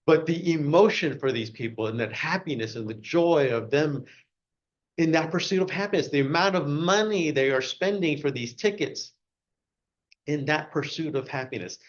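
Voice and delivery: ordinary delivery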